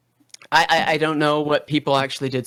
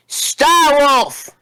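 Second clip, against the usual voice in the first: grand proclamation; 9 semitones higher, 4 dB louder than usual. Acted. Villain proclamation.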